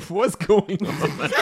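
real laughing fit taking over the words; breaking into laughter. Real, not acted. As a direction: crack up mid-sentence. Breaks up laughing mid-story.

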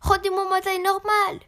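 high, loud furious demand; enraged yell. A narrator's reading. Shrunken Mr. Malpoli to the goblin, enraged yell. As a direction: yell it high, in a storybook rage. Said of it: "hurla monsieur Malpoli, fou de rage".

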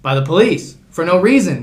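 normal talking voice

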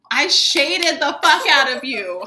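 real exasperated outburst, drawn out; loud incredulous. Real, not acted. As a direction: punch it out slowly, stunned at the compliment. Incredulous at being called classy.

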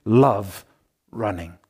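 "love" said slow and weighty, "running" dropped low after a pause; stress on LOVE as noun. Shown as a demonstration. Preacher explaining the name Love Running, stress on "love". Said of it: "The emphasis is on love as a noun".

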